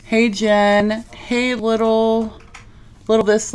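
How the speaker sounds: usual speaking voice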